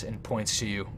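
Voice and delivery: ordinary talk, nothing marked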